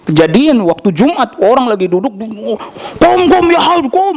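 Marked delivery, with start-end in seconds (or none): 3.0-4.2 much higher, imitating an irate guard; hammed-up angry shooing in Arabic; a Saudi guard shooing people off, mock angry; mock angry scolding. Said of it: "marah-marah".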